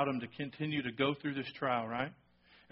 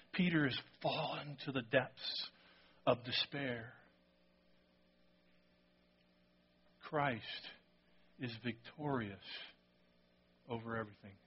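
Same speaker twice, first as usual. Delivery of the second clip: solemn hush; 3 semitones lower, 9 dB quieter than usual. Real, not acted. Pastor to congregation on Christ's victory, hushed. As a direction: drop to a reverent hush.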